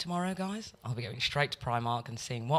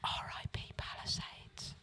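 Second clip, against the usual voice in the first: softer, hushed whisper; mock solemn whisper; 15 dB quieter than usual. Mourning a demolished shopping centre in a hushed whisper. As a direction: whisper it like a eulogy.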